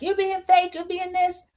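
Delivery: high pitched mock accusation. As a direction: toss the accusation back mockingly. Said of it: "And Liz is like".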